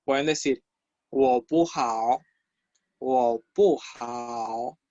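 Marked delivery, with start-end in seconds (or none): 1.1-2.2 slow, drawn-out Mandarin tones; careful, stretched teaching model; slow language demo. Shown as a demonstration. 3.0-4.8 low, very drawn-out syllables; syllable-by-syllable Mandarin model; very slow language demo. Shown as a demonstration. Teacher repeats it slower for learners.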